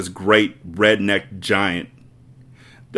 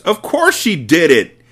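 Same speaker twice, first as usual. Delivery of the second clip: slow, loud boom; 9 semitones higher, 7 dB louder than usual. Acted. Headmistress contradicting Miss Honey, slow loud boom. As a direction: boom it down at her. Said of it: "Miss Trunchbull boomed".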